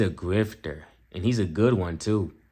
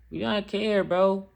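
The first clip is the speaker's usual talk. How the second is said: dismissive higher repeat; 9 semitones higher than usual. Acted.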